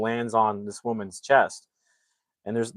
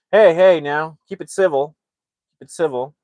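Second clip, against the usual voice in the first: raised, higher-pitched 'hey now' easing off; calling to order; 6 semitones higher, 9 dB louder than usual. Real, not acted. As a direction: break up a squabble, starting loud. Calls chatting viewers to order.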